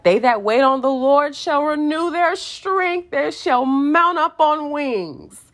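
high, put-on recital of scripture; scripture-quoting churchgoer. People quoting scripture about waiting on God, put-on piety. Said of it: "they're just like, always like".

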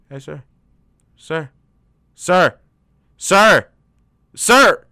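trying to wake a dozing customer, rising call. Retold or imitated. Calling out, growing louder each time.

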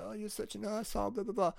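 high, mocking whiny voice of his younger self; mock crying self. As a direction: whine it like a hurt kid, half-mocking. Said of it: "I was like".

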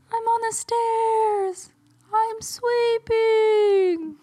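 high, loud, long-drawn wail; ghostly daughter. Acted. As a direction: float each word out like a ghost. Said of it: "She's a ghost".

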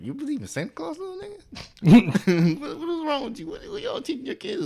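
high mocking cop voice breaking into laughs; mock the family like a disbelieving cop; incredulous cop.

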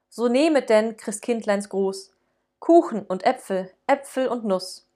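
measured, solemn recital with weighty stresses; poem recitation. Acted. Host reciting Storm's poem to co-host, recital. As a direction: declaim the Christmas poem like a school recital.

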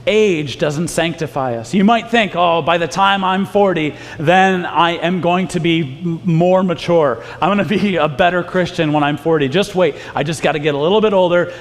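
ordinary talk

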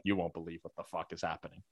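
fast, hushed aside in her ear; fast quiet gossip in a friend's ear; whispering to a friend. Retold or imitated. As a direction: lean in and murmur urgently. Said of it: "I'm in Sarah's ear".

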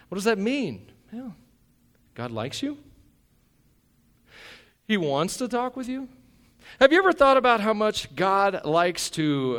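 ordinary talk, nothing marked

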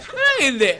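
high, loud teasing jab; teasing disbelief. Real, not acted. Co-host doubting that the other ignores, teasing disbelief.

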